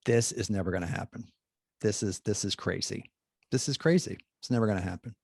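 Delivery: low, hushed private disbelief; disbelieving inner thought. Retold or imitated. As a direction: mutter it quietly to yourself. Doubtful inner thought, retold.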